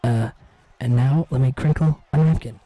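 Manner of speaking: low, close, hushed mock ASMR murmur. Streamer doing a joking ASMR bit, low and close. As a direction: purr it into the mic like ASMR. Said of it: "That A S M R was hot".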